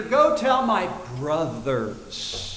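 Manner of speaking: slow, gentle and loving; tender. Retold or imitated. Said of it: "He says to Mary".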